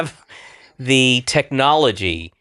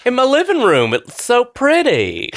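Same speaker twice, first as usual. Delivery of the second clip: put-on Southern accent; 11 semitones higher than usual. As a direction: gush it in a playful Southern accent. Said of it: "Why are you doing a Southern accent?".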